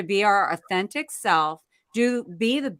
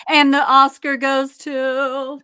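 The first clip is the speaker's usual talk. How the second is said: award announcer; 4 semitones higher, 7 dB louder, slower than usual. Pretend.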